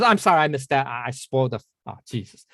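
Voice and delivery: ordinary delivery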